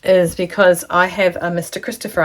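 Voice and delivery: ordinary talk, nothing marked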